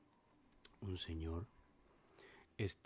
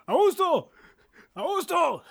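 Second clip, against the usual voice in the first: high, loud alarmed call; worried shout; 19 dB louder than usual. Acted. Worried Mario calling housemate during tremor, high shout. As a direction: call out in mock panic. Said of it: "todo preocupado dice".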